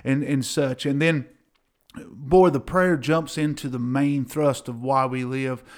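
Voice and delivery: ordinary delivery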